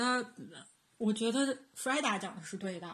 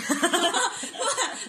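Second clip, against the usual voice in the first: laughing; 10 semitones higher, 6 dB louder than usual. Real, not acted. Laughing at the PhD mix-up.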